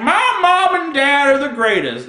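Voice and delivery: high, loud sing-song mock praise; mock gushing kid. Comic mocking arbitrary respect for parents, mock gushing.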